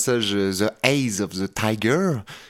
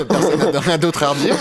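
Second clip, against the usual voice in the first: laughing through the words, louder and higher; amused laugh breaking into the line; 7 semitones higher, 6 dB louder than usual. Real, not acted. Laughing as the review panel falls silent.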